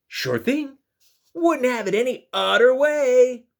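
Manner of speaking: high, loud eager invitation; warm-hearted Narwhal. Acted. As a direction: convince your best friend to join.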